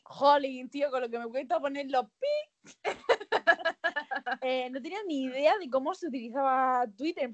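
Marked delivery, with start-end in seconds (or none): 0.7-2.7 laughing. Real, not acted. Laughing while speaking.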